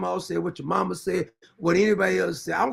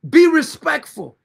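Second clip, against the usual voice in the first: loud, slow, raised-pitch warning; stern demand; 6 semitones higher, 8 dB louder, slower than usual. Real, not acted. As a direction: lay down the law, loudly and slowly. Warning a commenter to back off.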